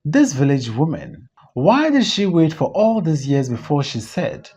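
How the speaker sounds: ordinary delivery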